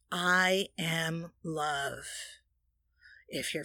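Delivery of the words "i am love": slow, softer I am love; a calm, drawn-out statement; slow affirmation. Shown as a demonstration. Offering the affirmation to listeners, slow and soft.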